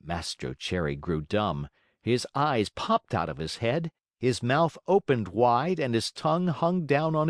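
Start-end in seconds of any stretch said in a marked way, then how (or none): none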